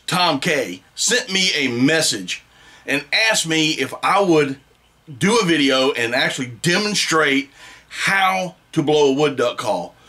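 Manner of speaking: ordinary talk, nothing marked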